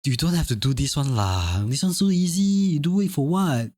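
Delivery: light Singlish accent with "lah", pitch rising at the end; casual Singlish demo. Shown as a demonstration. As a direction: show how you talk to Singaporean friends. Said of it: "something along the line like".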